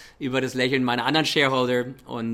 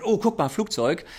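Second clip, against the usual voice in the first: excited exclamation; 8 semitones higher than usual. Retold or imitated. Excited quote spotting plane.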